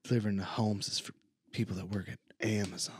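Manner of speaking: whisper. Pretend. Whispered joke continues.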